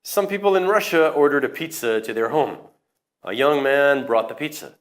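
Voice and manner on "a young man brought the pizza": normal talking voice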